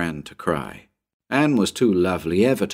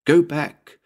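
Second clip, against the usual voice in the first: thin entreaty; 8 semitones higher, 3 dB louder than usual. Acted. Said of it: "he heard Anne's thin entreaty".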